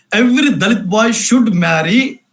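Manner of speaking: ordinary talk, nothing marked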